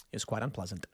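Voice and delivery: quiet deadpan repeat; muttered low aside. Shown as a demonstration. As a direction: drop the voice to a dry, quiet mutter. Repeats the line, dropping low.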